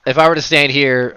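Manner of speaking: pushing through the table noise. Real, not acted. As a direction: talk loudly over the laughter. Raised over the table's laughter.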